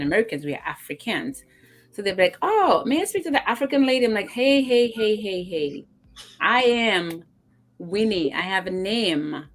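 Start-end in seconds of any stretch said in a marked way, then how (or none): 2.4-4.0 high, loud mock-polite request; mimicked parent. Parent asking worker for the African lady, mimicked. 4.3-9.5 retold protest, loud burst then slowing down; mock indignant. African worker correcting parents about her name, mock indignant.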